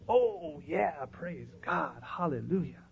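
soft, drawn-out mock piety; hammed-up hushed praise, dragged out slowly; fake worship. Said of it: "Fake worship".

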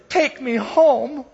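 put-on high, slow little-boy voice; a lost small boy pleading to be taken home; crying little boy.

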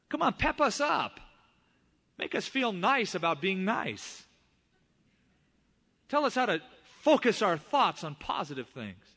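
high-pitched mock plea from complacent churchgoers; mock pleading. Mimicking people wanting upbeat sermons.

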